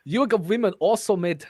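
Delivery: attempted South African accent. Reader attempting an accent for a script, South African accent. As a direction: read it in a shaky-grasp South African accent. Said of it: "I can't do a South African accent".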